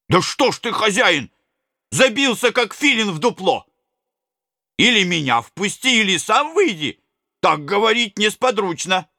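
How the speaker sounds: a lightly voiced eager old servant. A narrator's reading. Mikheich urges the miller.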